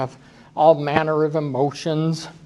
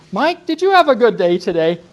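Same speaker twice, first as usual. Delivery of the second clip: pointedly sweet question to Mike; teasing the new staff member by asking Mike directly; sarcastic mock question; 6 semitones higher, 3 dB louder than usual. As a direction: ask it with sly sarcasm. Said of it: "I say".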